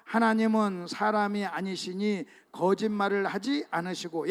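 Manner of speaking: slow, loud unison scripture reading; congregational reading. Real, not acted. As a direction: read the verse aloud together, slowly. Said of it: "시작".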